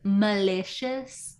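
put-on slow Miami accent, drawn out. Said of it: "or Miami accent".

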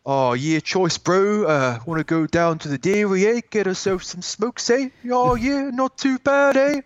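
high-pitched mock Maori New Zealand accent; teasing country-bumpkin Kiwi impression. Shown as a demonstration. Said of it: "I try and do the accent".

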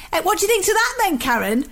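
quick, high-pitched northern English chatter; brash northern English teenager; strong northern accent. Retold or imitated. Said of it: "a strong northern accent then".

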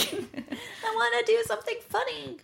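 put-on high whiny kid voice; naughty child voice. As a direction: play the bratty child. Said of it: "annoying, naughty child".

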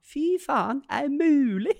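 put-on high, child-like swearing outburst; a small boy cursing in shock. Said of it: "og så sa han". (gutten banner høyt og forundret)

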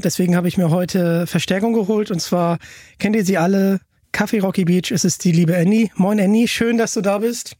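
ordinary delivery

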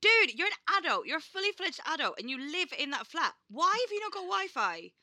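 high-pitched, mock-outraged rebuke; exasperated scolding. Real, not acted. Sister hears she won't pay for Wi-Fi, exasperated scolding. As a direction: take her to task, high and incredulous.

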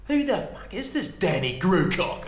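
high, loud, drawn-out challenge; heckled comedian's retort. Acted. Comedian picks up on the heckle, loud mocking challenge. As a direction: call it out slowly to the whole room. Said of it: "comedian picks up and he goes".

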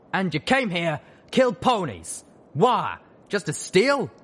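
loud, high, accusing shout; angry father. Acted. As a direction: demand answers, furious.

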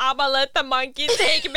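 mocking bratty kid. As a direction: tease her by playing the brat. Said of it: "Du är bara".

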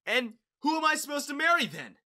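high, loud yell over screeching. A narrator's reading. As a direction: yell to be heard over a din. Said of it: "I yelled, trying as much as I could".

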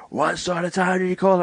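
high-pitched indignant scolding, imitated; mock fuming neighbour. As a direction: tell them off like an irate neighbour. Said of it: "fucking not happy".

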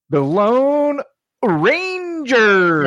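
high, drawn-out hammy band-name call; goofy announcer voice. Pretend. Podcaster announcing the Lone Rangers band, hammed-up high voice. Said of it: "I say that like that all the time".

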